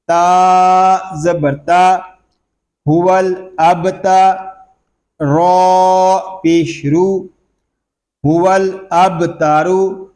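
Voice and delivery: ordinary talk, nothing marked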